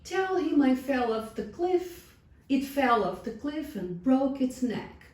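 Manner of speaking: high, urgent coaxing; coaxing lady. A narrator's reading. Lady presses the shepherd to lie about the lamb, high coaxing.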